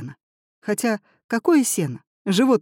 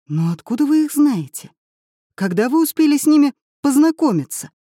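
louder and higher in disbelief; astonished exclaim; 8 semitones higher, 6 dB louder than usual. A narrator's reading. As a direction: exclaim in total confusion. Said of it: "воскликнул Семён".

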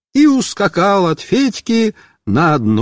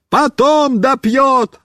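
high, slow yell; bawling; 7 semitones higher, slower than usual. Acted. Boy bawls at friend from the car, bawling. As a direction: bawl it back. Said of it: "ору я".

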